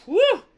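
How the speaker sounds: high loud whew of relief; relieved whew. Acted. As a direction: blow out relief after a long tale. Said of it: "he's like".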